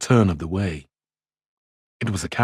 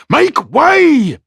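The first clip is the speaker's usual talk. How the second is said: much higher, louder cry; cried out; 8 dB louder than usual. A narrator's reading. Men around him crying out to clear the road, loud cry. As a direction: shout to clear a path. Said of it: "cried the men all about him".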